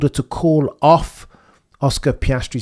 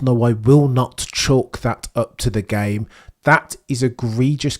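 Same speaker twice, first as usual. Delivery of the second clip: usual speaking voice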